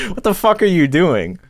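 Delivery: high-pitched, amused disbelief; imitated incredulous manager. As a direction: confront him in mock bewilderment. Acted manager confronting yelling guest, amused telling.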